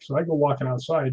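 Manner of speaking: normal talking voice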